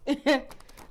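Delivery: short breathy laugh breaking through; brief nervous laugh. Real, not acted. Reader laughs to reassure her viewers, nervous laugh.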